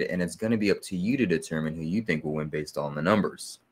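usual speaking voice

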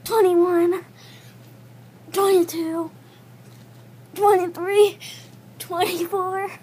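straining, breathless count; doing push ups. Real, not acted. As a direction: count out loud through the strain. Push-ups counted during one minute.

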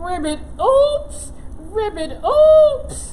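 startled little frog croaking oops. Acted. Frog puppet tumbling into the hole, yelping. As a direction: play the clumsy frog, yelping as he falls.

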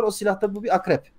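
usual speaking voice

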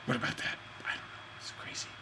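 breathy muttered aside, barely audible; whispered musing to himself. Real, not acted. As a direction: drop to a private whisper.

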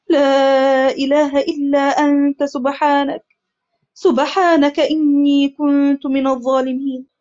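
slow, drawn-out recitation of a supplication; solemn, slow devotional recitation; prayer. Acted. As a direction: recite each word slowly like a prayer.

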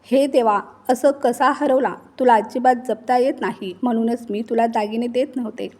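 ordinary delivery, nothing marked

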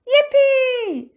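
very high, loud whoop; delighted cheer. A narrator's reading. Jinx cheering about dessert, high excited whoop. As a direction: cheer like a thrilled child.

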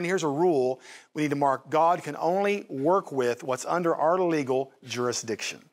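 normal talking voice

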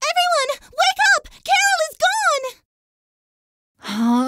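high, urgent cry of alarm; alarmed wake-up call. Acted. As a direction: rouse everyone with high-pitched urgency. Waking others with alarming news.

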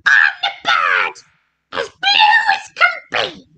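squawky put-on bird voice with big pitch leaps; bird character. A narrator's reading. Bird asking the witch for room, squawky character voice. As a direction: play a storybook bird, swooping up high. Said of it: "then said to the witch".

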